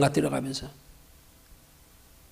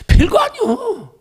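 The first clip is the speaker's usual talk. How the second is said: slow, much higher, stretched-out dismissal; drawn out; 4 dB louder, much slower than usual. Real, not acted. Preacher dismissing death to the congregation, slow and high. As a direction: drag it out, make death sound trivial.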